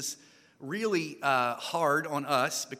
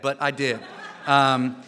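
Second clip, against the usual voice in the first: laughing at himself, louder; a sheepish laugh at his own past; embarrassed laugh; 8 dB louder, slower than usual. Real, not acted. Preacher laughing about miming in Trinidad. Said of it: "miming in Trinidad".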